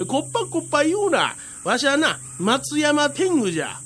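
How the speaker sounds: high, fast, huffy protest; indignant little tengu. Acted. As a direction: squeak it out in offended pride. 小天狗がこっぱと呼ばれて怒る. (甲高く怒る小天狗)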